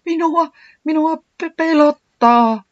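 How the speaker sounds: very high, stuttering, last word stretched loud; terrified stammer. A narrator's reading. Tiputi faces the audience with chattering beak, stammering in terror. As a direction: stammer it out in stage fright. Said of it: "nokka alkoi kalista pelestyksestä".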